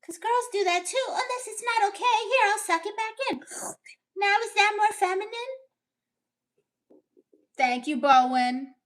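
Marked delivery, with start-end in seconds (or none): 0.0-5.3 put-on very high girly falsetto, dainty; hammed-up high ladylike voice; feminine voice. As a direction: play a prim lady apologising sweetly. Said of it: "in your feminine voice".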